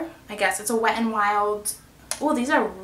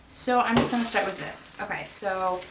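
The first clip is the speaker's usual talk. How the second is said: ordinary talk, nothing marked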